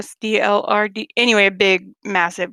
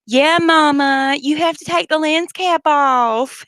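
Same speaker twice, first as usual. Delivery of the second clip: higher, slower playful echo of Yeah, Mama; [name] echoing the Southern girl, slow and high; mimicking with a twang; 5 semitones higher, 4 dB louder, slower than usual. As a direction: tease the line back high and drawn out. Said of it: "looks at my mother and goes".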